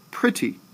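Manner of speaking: pretty starting high on pre; stress on first syllable. Shown as a demonstration. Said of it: "I start off stressing that word".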